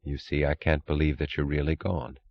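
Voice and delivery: soft, subdued, hiding emotion. A narrator's reading. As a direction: keep it down so the feeling barely shows. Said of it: "Her voice was dropping lower".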